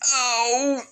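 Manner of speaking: high startled yelp; surprised. Acted. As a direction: caught off guard by the tackle. Izuku gets knocked down.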